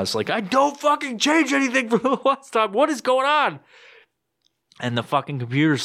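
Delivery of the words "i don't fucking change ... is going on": mock scream. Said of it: "Hear me scream about that".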